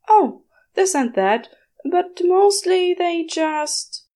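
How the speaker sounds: high, slowing, trailing off embarrassed; embarrassed Aziraphale. A narrator's reading. Aziraphale fumbles explaining what the humans do. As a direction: stall awkwardly then trail off.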